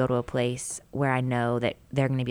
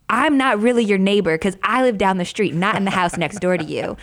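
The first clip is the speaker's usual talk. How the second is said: raised, louder mock-kid protest; arguing kid; 4 semitones higher, 6 dB louder than usual. Mom imitating a neighbor kid arguing with her daughter, protesting. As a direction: imitate a child quibbling in a squabble. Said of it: "in a little fight with another kid".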